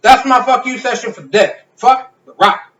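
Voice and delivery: loud, high-pitched sign-off to a rant; emphatic outburst. Real, not acted. Podcast host capping his rant against The Rock, emphatic outburst.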